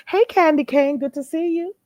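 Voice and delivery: ordinary delivery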